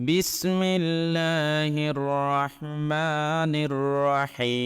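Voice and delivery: slow, drawn-out chanted recitation; Quran recitation. Shown as a demonstration. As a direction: recite with long melodic vowels.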